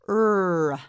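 "r" as a long held rrr-uh, not the letter's name; isolated R sound. Shown as a demonstration. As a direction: hold the sound of "r", releasing into uh. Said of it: "The letter R makes the sound".

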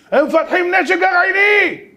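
high, slow, loud alarmed cry in a changed voice; frantic philosopher shouting about nuclear arms; panicked shout. Retold or imitated. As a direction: yell the danger at him.

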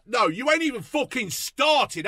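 ordinary delivery

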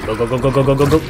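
loud, rapid go-go-go hype; excited urging. Real, not acted. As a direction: push your teammates on, loud. Urging on during a fight.

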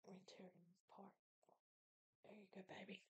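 soft unvoiced whisper. Real, not acted. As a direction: whisper it to the pet. Whispered to pet.

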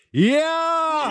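high triumphant shout; rowdy party animal. Real, not acted. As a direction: cheer like you just chugged it. Cheer after downing drink.

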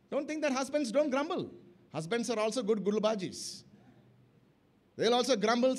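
ordinary delivery

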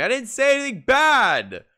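high, loud, offended yelling; indignant protest. Real, not acted. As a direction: shout back at the screen as if wrongly accused. Protesting at the anime character.

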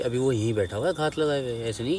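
hushed warning. Real, not acted. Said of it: "silence हो जाओ".

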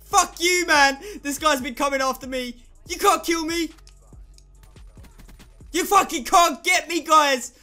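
loud gloating taunts; triumphant yelling. Real, not acted. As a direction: shout in victory. Taunting enemies mid game.

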